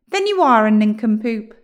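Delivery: loud, scornful witch retort. A narrator's reading. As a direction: throw the insult back loudly. Witch insulting William back.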